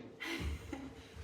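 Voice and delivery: soft airy chuckle; quiet laugh. Real, not acted. Wife laughing at husband's ventilation excuse, soft chuckle. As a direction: chuckle under your breath.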